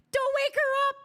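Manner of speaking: high-pitched shout; high loud outcry. Real, not acted. Streamer blurting out not to wake her, high loud outcry. Mock panic at waking someone.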